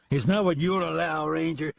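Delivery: put-on a drunk bully squaring up; angry drunken voice. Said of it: "in an angry, drunken voice".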